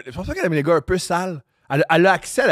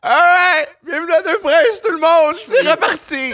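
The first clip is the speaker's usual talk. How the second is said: excited high greeting; 13 semitones higher, 7 dB louder than usual. Real, not acted. High shout after laughing.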